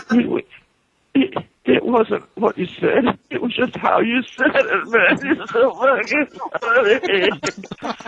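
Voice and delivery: high, slow, laughing through the words; imitating a laughing stoned friend. Friend helpless with laughter, explaining why the teller is funny. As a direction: wheeze it out mid-giggle. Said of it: "He goes".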